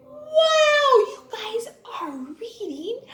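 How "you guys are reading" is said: soft excited whisper of praise. Real, not acted. Teacher praising pupils' reading, secret whisper. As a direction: lean in and whisper the good news. Praising kids in hushed aside.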